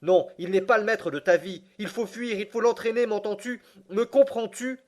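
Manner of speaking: plus aigu et fort, presque hors de soi; cri insensé. Acted. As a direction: supplier en criant, affolé. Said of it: "m'écriai-je, insensé". (le neveu crie au guide, hors de lui)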